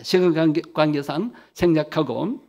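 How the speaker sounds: ordinary talk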